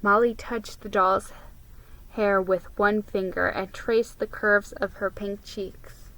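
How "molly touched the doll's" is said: ordinary delivery, nothing marked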